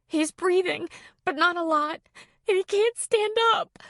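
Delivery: high tearful voice; tearful child. A narrator's reading. Crying girl telling Kelly about the dog, tearful. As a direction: speak through tears.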